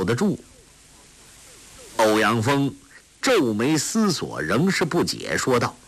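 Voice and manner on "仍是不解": plain reading voice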